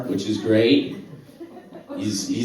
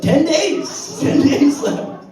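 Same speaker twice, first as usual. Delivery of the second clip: high, loud exclamation; loud, high-pitched amazement; incredulous outburst; 6 dB louder, much slower than usual. Real, not acted. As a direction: shout it in sheer disbelief. Incredulous repeat of punchline.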